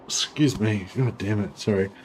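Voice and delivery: uncomfortable, pained from heartburn. Real, not acted. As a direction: wince through a heartburn twinge. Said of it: "Got some heartburn issues going on".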